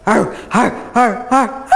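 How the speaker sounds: light, chirpy imitated barks; friendly dog bark. Shown as a demonstration. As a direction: bark like a playful pup. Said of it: "friendly and appeasing signals tend to be more chirpy".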